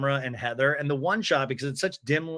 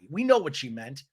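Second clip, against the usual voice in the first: usual speaking voice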